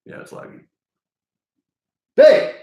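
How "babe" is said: high, loud shout of her name; wife not answering, loud call. Real, not acted. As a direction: yell her name across the house. Calling to wife again.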